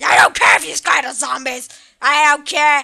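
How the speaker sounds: a dad who just doesn't care; gruff dad shout. Pretend. Dad yelling back at his scared son, a loud, dismissive shout.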